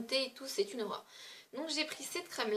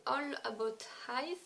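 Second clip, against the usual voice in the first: slow English brand name in a clumsy English accent; a French speaker's awkward English accent; slower than usual. Real, not acted. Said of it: "Désolée pour mon accent anglais qui est pitoyable".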